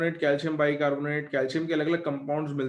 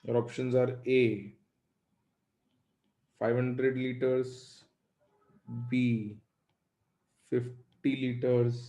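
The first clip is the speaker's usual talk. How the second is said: slow, word by word for copying; slow dictation; 3 semitones lower, much slower than usual. Shown as a demonstration. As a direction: dictate it slowly so they can write. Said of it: "लिखो". (धीरे धीरे लिखवाना)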